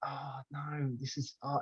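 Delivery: hushed imitated alarm; mild mock panic. Watching a wrestling match, hushed mock panic. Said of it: "I'm really panicking".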